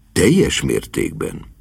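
low, slow, measured reply; composed butler. A narrator's reading. Butler confirming he is ready, composed. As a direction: answer slowly in a deep butler's voice. Butler answers the eager niece.

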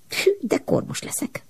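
high, breathy whisper to herself; a little girl whispering in alarm; whispered thought. A narrator's reading. Ilus in the dark chimney, whispering to herself. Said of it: "gondolta magában".

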